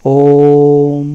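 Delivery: long, resonant Om, held out; chanted Om; drawn-out Om. Shown as a demonstration. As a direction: sustain the Om like a Vedic chant. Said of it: "ओम जो है वो थोड़ा लंबा बोला जाता है".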